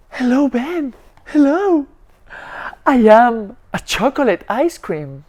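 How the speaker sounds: high, sweet pleading food voice; talking ice cream. Acted. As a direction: coax Ben like a tempting treat. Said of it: "And the ice cream said".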